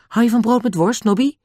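high-pitched, friendly question; girl character voice. A narrator's reading. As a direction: voice a girl offering food. Little girl offers food.

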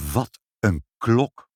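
slow, drawn-out wonder; marvelling headshake. A narrator's reading. Mother slicing bread and remembering the clock, marvelling. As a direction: linger on each word in disbelief. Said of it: "telkens hoofdschuddend zei".

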